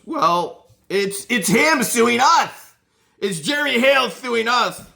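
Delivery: high, loud mocking imitation of her; squeaky put-on voice mocking the witness. Mocking her court answer.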